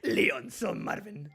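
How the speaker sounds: slow, drawn-out dub-actor read; lightly imitated Spanish dub; mock dub line. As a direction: savour each word like a cheesy dub. Echoing the game's dub line.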